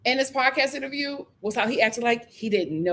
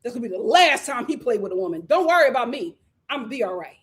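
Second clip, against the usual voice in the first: ordinary talk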